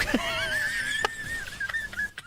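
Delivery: high screaming laughter; shrieking laugh. Real, not acted. Laughs after the death.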